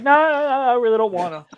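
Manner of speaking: high, whiny imitation of a friend; lightly mocking pleading voice; mock whiny refusal. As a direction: whine it like a kid refusing help. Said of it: "he's like".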